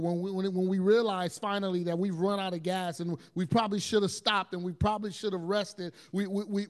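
ordinary delivery

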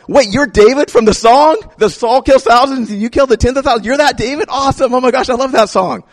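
high, gushing fan voice; starstruck fan. Retold or imitated. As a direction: sound thrilled to meet a celebrity. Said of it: "they're like".

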